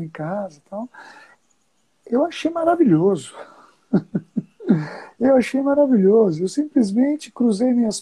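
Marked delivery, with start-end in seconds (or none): 3.9-4.4 brief real chuckle. Real, not acted. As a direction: chuckle lightly at your own remark. Ri do próprio comentário. (risadinha curta)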